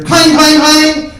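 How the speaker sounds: high, ringing shouted clangs; loud bell imitation. Comedian imitating the bell on a kicked-in door, loud shouting. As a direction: belt it out like a violently shaken bell.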